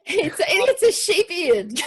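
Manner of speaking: laughing. Real, not acted. Host laughing at sheep beard mask.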